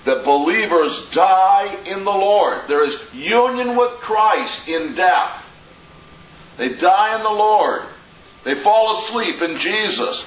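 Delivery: loud, high, ringing pulpit voice; forceful preaching. Acted. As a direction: preach it out to the whole room.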